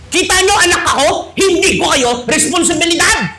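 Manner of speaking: mock indignant shout; defiant child. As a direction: throw it at the parents.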